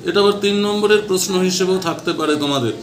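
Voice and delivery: ordinary delivery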